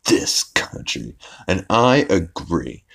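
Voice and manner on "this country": low, slow, half-whispered; disgusted mutter. Retold or imitated. Principal grumbling about the country, low, slow and whispery. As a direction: breathe this country in weary disgust. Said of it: "the principal's like".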